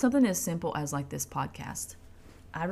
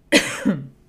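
loud sudden cough; coughing fit; 13 dB louder than usual. Real, not acted. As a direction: cough hard into the mic. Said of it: "I'm coughing so much".

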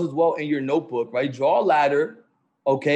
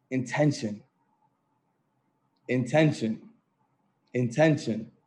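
low hushed repeats; 4 semitones lower, 6 dB quieter than usual. Real, not acted. Coach to the call, hushed and repeating Intention. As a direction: drop low and soft, and let each Intention land alone. Coach drives the key word home.

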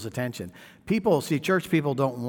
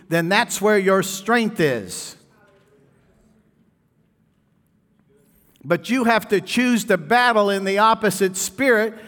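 louder, higher preaching voice; full-force preaching; 7 semitones higher, 6 dB louder than usual. Real, not acted. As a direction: preach it with fire.

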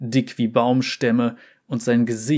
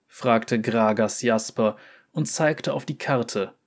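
ordinary delivery, nothing marked

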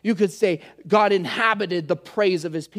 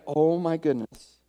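acted weariness; 5 semitones lower, 3 dB quieter than usual. Believer in a hard moment of the week, acted weariness. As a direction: let it sink low, as if worn down.

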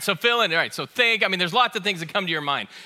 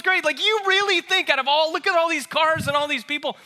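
much higher, louder mock wife voice; wife's disbelief; 9 semitones higher, 3 dB louder than usual. Wife mocks his driving claim, high incredulous. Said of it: "And then she goes".

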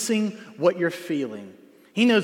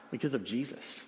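ordinary delivery

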